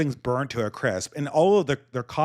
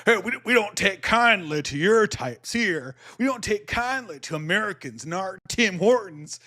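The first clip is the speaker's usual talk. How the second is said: hostile Southern local; 8 semitones higher than usual. Retold or imitated. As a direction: play the local who wants outsiders gone.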